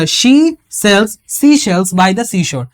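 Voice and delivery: "She sells" said very slowly, every s and sh sound leaned on; stressing the s sounds. Shown as a demonstration. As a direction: drag out the hissing s and sh sounds. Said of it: "এস ধ্বনি আর SH ধ্বনি". ("She sells" খুব ধীরে, প্রতিটা স আর শ ধ্বনি জোর দিয়ে)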